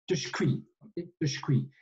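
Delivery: "tujkui" said as tushkwi; j becomes sh. Shown as a demonstration. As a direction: say "tujkui" with the j devoiced to sh. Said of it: "peut se transformer en ch". (« tujkui » prononcé tuchkwi, le j devenu ch)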